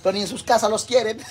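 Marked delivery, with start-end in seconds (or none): 1.3-1.3 breaking into laughter at own joke; laughing. Real, not acted. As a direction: laugh through the line.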